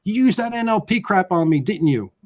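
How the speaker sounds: high-pitched mock accusation; accusing wife. Wife to husband in a knockdown fight, mock accusing. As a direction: imitate a wife accusing her husband mid-argument. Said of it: "in the knockdown fight brawl".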